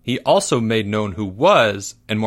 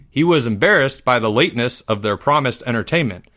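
plain reading voice

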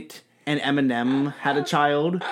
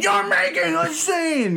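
high, slow mock shriek; mock shrieking celebrity; 14 semitones higher, slower than usual. Friend imagining [name] scolding fans, high mock shriek. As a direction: shriek it like an outraged star. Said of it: "It's like".

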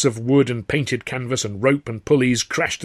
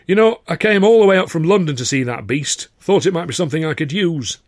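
put-on high, loud, broad northern English accent; forceful stranger with a broad northern accent; thick regional accent; 6 semitones higher, 5 dB louder than usual. A narrator's reading. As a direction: hold forth in thick northern English, pitched up. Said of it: "a voice with a thick sort of accent".

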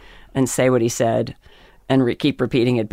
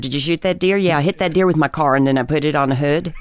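Pennsylvania accent; faster than usual. Shown as a demonstration. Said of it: "You talk like that".

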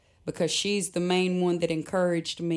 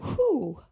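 high breathy sigh of relief; relieved whew; 7 semitones higher than usual. Retold or imitated. Friend comforted her in the van, now a relieved whew.

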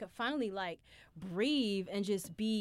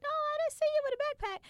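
very high, fast put-on girl voice; roommate voice; much faster than usual. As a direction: chirp it like a friend. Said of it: "She's like".

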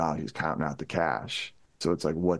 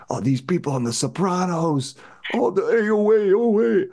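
much higher, louder indignant Italian-American rant; Danny Aiello impression; 13 semitones higher, 5 dB louder than usual. Host imitating an actor ranting about Sopranos accents, high loud indignant. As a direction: blow up like a proud old Italian guy fed up. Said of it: "And he would go on and on".

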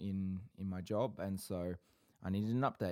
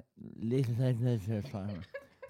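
laughing through a full mouth; stifled laugh with mouth full; muffled laugh; 2 semitones higher, 5 dB louder than usual. Real, not acted.

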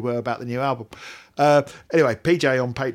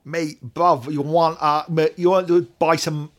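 louder, higher mock London street slang; bad London street accent; 4 semitones higher, 6 dB louder than usual. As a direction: hawk the album like a London street dealer, badly. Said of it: "what's the hell is that accent?".

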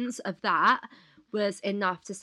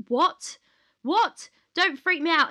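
higher, louder retold panic; alarmed disbelief; 9 semitones higher, 8 dB louder than usual. Reading a man's red-flag comment, higher and alarmed. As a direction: replay the jolt of reading it.

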